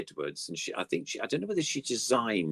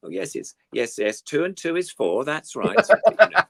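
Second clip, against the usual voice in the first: higher, chirpy mock presenter praising Sooty; mock presenter to puppet; 8 semitones higher, 6 dB louder than usual. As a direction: coax the puppet like a kids' show host, higher and louder. Mimicking presenter talking to puppet.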